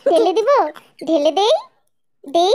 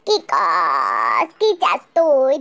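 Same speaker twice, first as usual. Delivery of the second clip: much lower, slow growl; gruff retort; 9 semitones lower, much slower than usual. Acted. Annoyed man answering the singer, low and slow. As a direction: drop the voice low and rough.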